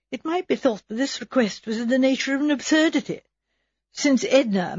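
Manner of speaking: ordinary delivery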